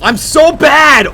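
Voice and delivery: high, drawn-out yell; loud high-pitched outburst at oneself; frustrated shout. Real, not acted. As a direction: yell it out in despair, stretching the words. Shouting at own bad play.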